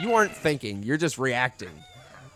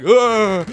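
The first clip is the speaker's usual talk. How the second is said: loud, higher fright noise; imitated startled gasp; 7 semitones higher, 10 dB louder than usual. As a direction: mimic a jolt of fright. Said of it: "when you get scared and you're like".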